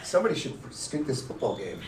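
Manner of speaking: soft, hushed devilish suggestion; whispering temptation. Retold or imitated. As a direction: tempt him quietly like the devil on a shoulder. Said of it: "Fireball whispered in my ear".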